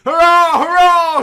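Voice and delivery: shouted. A narrator's reading. Wedding guests cheering the couple, loud shout. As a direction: yell it like a cheering crowd. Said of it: "shouted the guests".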